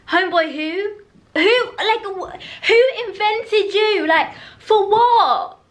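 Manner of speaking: high, incredulous rant; indignant outrage. Real, not acted. Ranting at the character Royce, high incredulous outrage. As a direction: demand to know who made him, outraged. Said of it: "I'm actually fuming at Royce".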